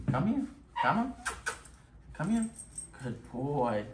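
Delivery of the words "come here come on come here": high, rising call to the dog; coaxing high call. Real, not acted. Trainer calling the dog over in a high coaxing voice. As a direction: sing it up high to coax him over.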